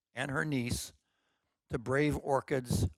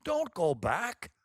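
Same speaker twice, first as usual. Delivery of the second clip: ordering; 6 semitones higher, 4 dB louder, slower than usual. Acted. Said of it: "ordered her son".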